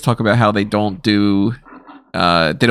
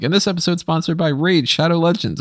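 higher-pitched imitation of a YouTube ad plug; mock sponsor read; 7 semitones higher than usual. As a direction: parody a YouTuber reading the sponsor line. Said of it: "whenever somebody adds that on their YouTube thing".

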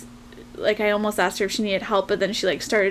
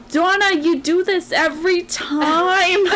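lightly imitated Boston friend scolding, higher and slow; a mock Boston accent, chiding an old friend; higher, slower Boston accent, playfully scolding; 8 semitones higher, 4 dB louder, slower than usual. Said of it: "she had a really thick Boston accent".

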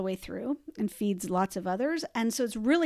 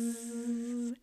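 buzzing bee sound; 8 dB quieter than usual. Shown as a demonstration. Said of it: "Let's try that".

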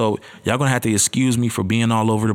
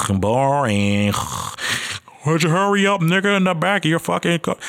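bored listener; 8 semitones higher than usual. Retold or imitated.

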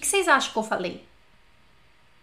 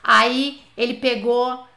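ordinary delivery

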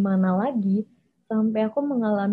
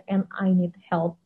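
ordinary delivery

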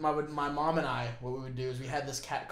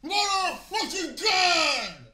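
long, high, drawn-out yell; show-off high scream; loudest shout; 6 dB louder, much slower than usual. Shown as a demonstration. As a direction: stretch every word into a holler. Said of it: "I just have a louder voice".

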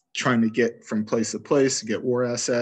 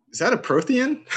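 high, surprised alien query; mock-surprised alien asking; alien character voice; 4 dB louder than usual. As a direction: pitch it way up like a startled alien. Character voiced in game retelling.

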